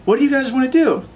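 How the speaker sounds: high, chirpy landlord voice; friendly landlord. Retold or imitated. Landlords greet them at building.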